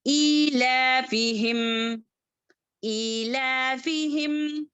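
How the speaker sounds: slow chant of ईला फी हिम, stretched; Quran recitation. Shown as a demonstration. Teacher models the verse for students, slow chant. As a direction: recite ईला फी हिम slowly, each syllable held. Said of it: "कैसे पढ़ेंगे?".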